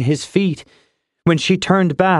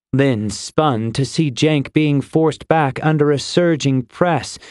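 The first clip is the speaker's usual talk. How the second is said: plain reading voice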